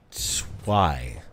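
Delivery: "Tswai" low and slow, ts gliding into why; sounding out pronunciation. Shown as a demonstration. Streamer trying a viewer's video-based pronunciation, sounded out carefully. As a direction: say "Tswai" as one syllable, ts-why. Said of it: "I see it at".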